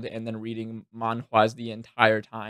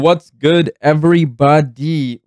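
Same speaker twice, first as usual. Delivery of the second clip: hype greeting; 4 semitones higher, 12 dB louder, slower than usual. Acted. Loud vlog greeting.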